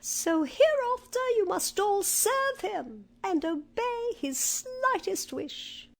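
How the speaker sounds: high-pitched royal mouse; squeaky mouse queen. A narrator's reading. Mouse queen orders her mice to serve him, high squeaky voice. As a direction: give orders in a tiny squeak. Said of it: "she answered".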